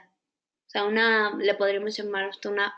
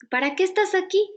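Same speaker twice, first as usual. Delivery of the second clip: much higher, curious question to her own feeling; 8 semitones higher than usual. Pretend. Coach talking to her own emotion, high curious question. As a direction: ask the emotion kindly, pitched up high.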